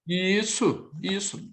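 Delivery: usual speaking voice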